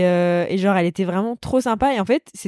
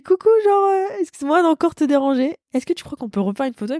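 much higher, squeaky shy request; timid fan voice; 8 semitones higher than usual. Retold or imitated. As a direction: ask sheepishly for one more photo. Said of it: "je dis".

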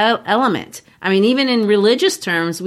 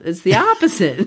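ordinary talk, nothing marked

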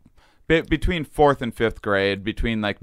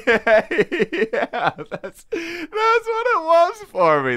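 laughing; much slower than usual. Real, not acted. Laughing agreement.

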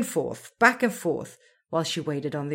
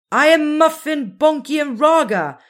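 higher, louder, careful reply; loud answer; 10 semitones higher, 10 dB louder than usual. A narrator's reading. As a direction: call out the names clearly. Muffin answering the voice.

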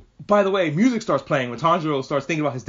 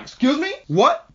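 much higher, louder incredulous "what?"; stunned disbelief; 11 semitones higher, 4 dB louder than usual. Retold or imitated. Reacting to the sister's surprise power, shocked and high. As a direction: jolt up in shock at the twist. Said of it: "And I'm like".

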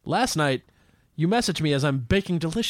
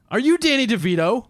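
higher, starstruck question; mock amazement; 7 semitones higher, 4 dB louder than usual. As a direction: play a deli manager spotting a celebrity. Improvised deli manager recognises star.

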